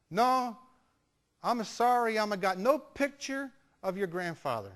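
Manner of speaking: put-on apologetic old Italian lady's English; Italian grandmother accent. Grandmother tells grandson she has no picture, Italian accent. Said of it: "nice little old Italian lady".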